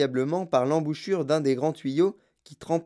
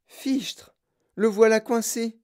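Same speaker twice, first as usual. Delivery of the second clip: surpris; 7 semitones higher, slower than usual. Acted. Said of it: "dit Charlie".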